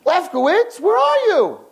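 very high-pitched calling out; acted high yell; screaming across room. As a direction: holler for a missing roommate, stretching the last word. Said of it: "he starts screaming across the room".

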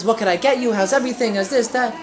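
lightly voiced cheery, smiley waitress. Waitress greeting a customer, mildly cheery. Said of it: "so nice, cheery, smiley".

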